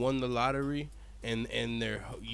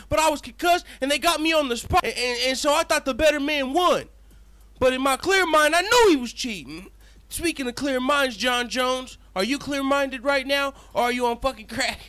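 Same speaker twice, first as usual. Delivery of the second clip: higher, louder ranting; heated yelling; 9 semitones higher, 6 dB louder than usual. Pretend. Venting at the opponent, higher and louder. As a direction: keep yelling at full heat. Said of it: "What he means to say is".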